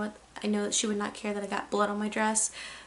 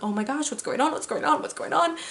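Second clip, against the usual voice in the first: mock panic, pitch climbing with each repeat; retold panic; 4 semitones higher than usual. Freaking out at the sight of blood, retold panic. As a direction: replay your rising alarm, repeating yourself urgently. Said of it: "I'm, like, panicking".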